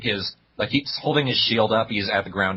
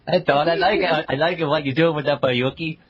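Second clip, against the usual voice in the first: higher, louder mock voice; silly put-on voice; 8 semitones higher, 3 dB louder than usual. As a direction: ham up a goofy accent. Mimicking voice in the abridged series.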